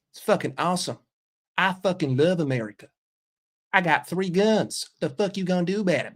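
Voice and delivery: put-on boastful good-old-boy Southern accent. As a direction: put on a proud, blue-collar Georgia accent. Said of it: "There's your Southern accent".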